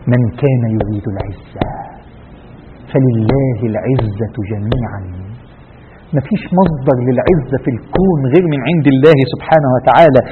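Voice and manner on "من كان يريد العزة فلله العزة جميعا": slow, low verse recitation; solemn recitation. Real, not acted. Preacher reciting the verse to close the story, solemn. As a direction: recite it low, drawing out each long vowel.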